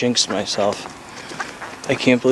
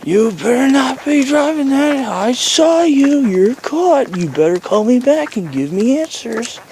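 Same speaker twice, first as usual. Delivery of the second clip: over-the-top imitation; 13 semitones higher than usual. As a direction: ham up a ridiculous accusing voicemail. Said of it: "really ridiculous over the top".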